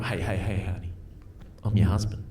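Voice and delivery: soft, low, soothing reassurance; tender, hushed husband voice; reassuring husband. Retold or imitated. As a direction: gently coax her, voice dropped and quiet. Husband reassures his embarrassed wife.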